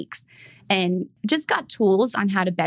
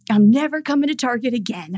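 put-on louder, indignant child's vow; toddler yell; 4 semitones higher, 4 dB louder than usual. Toddler refusing to return to Target, imitated yell. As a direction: stomp the words out like a toddler. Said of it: "And he yells".

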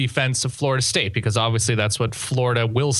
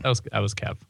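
lower, softer offhand reply; unenthused; 4 semitones lower, 5 dB quieter, faster than usual. Retold or imitated. Host echoing his own letdown of the co-host, unenthused. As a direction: play it down, low and soft. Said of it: "you were so unenthused".

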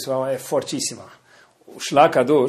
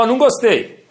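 mock-stern, raised and louder; feigned anger; 9 semitones higher, 5 dB louder than usual. Shown as a demonstration. Parent pretending to be cross at a child's lie, feigned anger. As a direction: put on a cross parent's firm objection. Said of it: "fingir que tá bravo".

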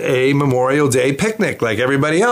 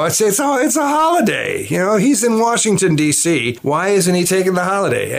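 high-pitched, incredulous grumbling; mock complaint; 7 semitones higher than usual. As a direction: act out griping about a holiday phone call. His annoyed thought at holiday call.

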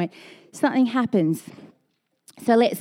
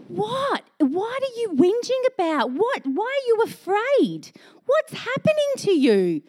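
higher, baffled just-woken Jesus; bewildered Jesus; 11 semitones higher than usual. Acted. Jesus woken by whinging disciples, higher and baffled. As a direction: act baffled at their fuss.

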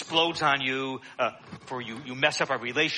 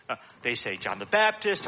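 ordinary talk

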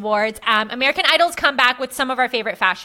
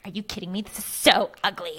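mock disgust; 6 semitones lower, 6 dB quieter than usual. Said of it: "she's like".

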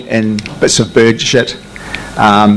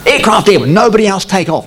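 higher, clipped radio command; radio order; 8 semitones higher than usual. Retold or imitated. Tower ordering all other aircraft to hold, higher commanding voice. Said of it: "They just said".

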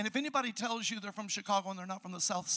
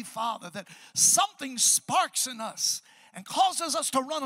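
fervent prayer; 3 semitones higher, 5 dB louder than usual. Acted. Pastor prays aloud over the church, fervent prayer. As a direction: lift it up to God, then settle.

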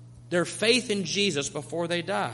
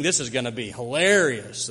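mocking audience; 6 semitones lower than usual. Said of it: "they are almost snickering".

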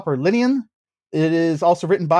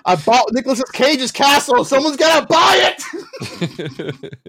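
hammed-up shout; 8 semitones higher, slower than usual. Host riffing on actor's castle quote, hammed-up shout. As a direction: shout the joke quote, stretching the end.

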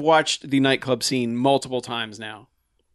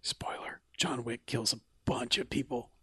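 whispering; 3 semitones lower, 14 dB quieter than usual. Pretend. Mock secret spoiler whisper.

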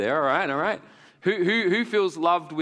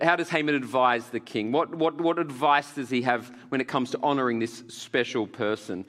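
ordinary talk

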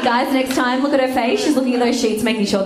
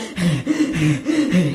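heavy acted panting; exaggerated puffing from the stairs; out-of-breath panting; 3 semitones lower than usual. Comic climbing five flights to a date, panting hard.

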